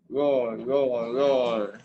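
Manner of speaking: loud playful dinosaur roar. Pretend. Child reading a dinosaur book aloud, loud playful roar.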